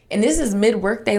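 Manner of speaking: ordinary talk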